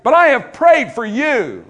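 high, loud, slow pulpit declaration; emphatic proclamation. Real, not acted. Preacher giving Jesus's answer to the devil's scheme, emphatic proclamation. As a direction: proclaim it with full force, drawing the words out.

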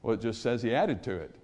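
a hint of a meek student; mild, softened meek voice; meek answer. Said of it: "raised his hand kinda meekly and he said".

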